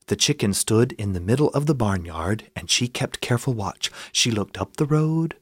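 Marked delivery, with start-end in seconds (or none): none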